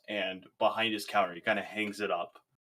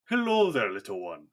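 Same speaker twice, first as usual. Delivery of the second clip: cheery greeting; 4 dB louder than usual. Acted. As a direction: greet the little one with delight. GM voices dwarf greeting child.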